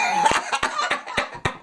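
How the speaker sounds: high, airy laugh with no voice; breathless laugh. Real, not acted. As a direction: laugh silently at herself. Laughing at the teasing.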